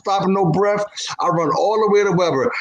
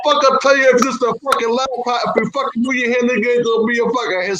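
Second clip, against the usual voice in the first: high, heated threatening voice; mock angry threat; 9 semitones higher than usual. Angry husband warns man, threatening. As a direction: threaten him as the fed-up enforcer.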